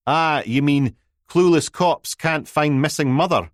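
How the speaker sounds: sneering mock headline; snide. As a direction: needle him, snidely. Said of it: "being a dick".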